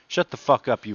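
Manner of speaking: ordinary delivery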